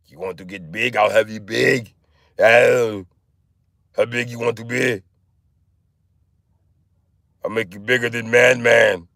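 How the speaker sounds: put-on accented bodybuilder promise; Arnold. Trainer promising to make someone bigger, Arnold impression. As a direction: talk like a muscle-man trainer. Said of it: "Arnold is in the room".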